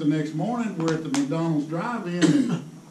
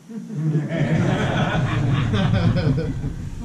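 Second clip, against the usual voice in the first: long loud burst of laughter; a real loud laugh; 5 dB louder than usual. Real, not acted. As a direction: laugh out hard at the punch. Laughing after phone line.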